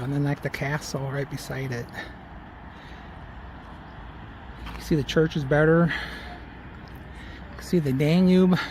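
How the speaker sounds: breathless after a steep climb; out of breath from climbing. Real, not acted. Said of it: "if I sound like I'm out of breath, it's because I'm out of breath".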